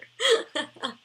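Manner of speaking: a real laugh breaking out at a memory; high bright laughter; loud laugh. Real, not acted. Remembering the angry bus driver.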